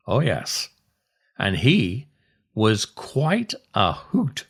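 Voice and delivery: ordinary delivery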